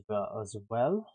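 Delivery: words slide into a light German accent by accident; slip into German accent. Real, not acted. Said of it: "I got a little bit of a German accent there".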